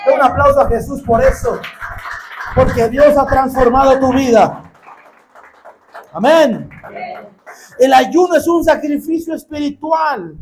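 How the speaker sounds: loud, driving pulpit preaching; rally the congregation at full voice; preaching at full force. Acted. Preacher exhorting the church, loud full-force preaching.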